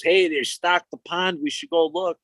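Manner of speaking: put-on a boy telling his buddy about the fish, eager; excited kid friend. Friend excitedly suggests checking pond.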